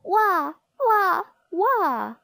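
high, loud storybook "wah" crying; mock baby wail. A narrator's reading. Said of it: "But still she cried".

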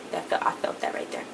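very soft, almost voiceless confiding murmur; hushed heartfelt aside. Real, not acted. Vlogger confiding that she felt the excitement too, hushed. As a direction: drop to a near whisper.

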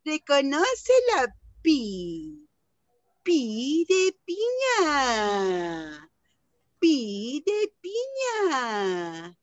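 very slow, drawn out syllables; slow classroom demo of "Pi de piña". Shown as a demonstration. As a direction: stretch every sound of "Pi de piña" for beginners. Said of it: "voy a hablar más despacito".

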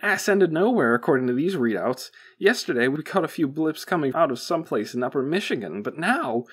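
high, loud technician reporting readouts; wide-eyed technician. A narrator's reading. Technician briefing the boss on readouts, wide-eyed and urgent. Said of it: "said the wide-eyed technician".

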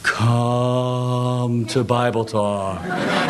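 very long, droning sing-song call; hammed-up slow chant; exaggerated mimicry. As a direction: drag every word out mockingly, low. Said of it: "He just exaggerates it".

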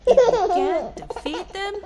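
high, loud laughing call; laughing excitement. Acted. Reader delighted at the twist, laughing. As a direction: burst out with delight.

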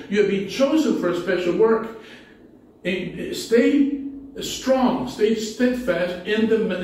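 ordinary delivery

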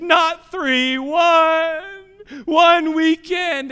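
mock child tantrum. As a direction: play the stubborn kid mid-tantrum. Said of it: "kicking and screaming".

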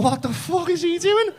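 high, slow, baffled exclamation; incredulous. Retold or imitated. Onlooker watches a lad rowing in the street, incredulous. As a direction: stretch the question out in high-pitched disbelief.

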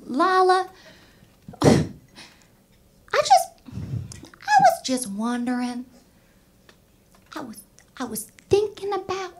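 high, halting Southern mom voice. Acted. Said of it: "I don't really care for slow talkers".

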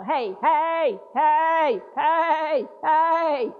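insistent repeated calls; calling hey repeatedly. Shown as a demonstration. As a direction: keep shouting hey for attention. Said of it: "over and over".

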